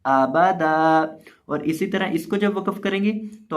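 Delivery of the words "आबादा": आबादा stretched very slowly; pausal form drawn out. Shown as a demonstration. Teacher showing how to stop on आबादा. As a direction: hold आबादा long as a stop. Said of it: "वक्फ के अंदर इसको पढ़ेंगे आबादा".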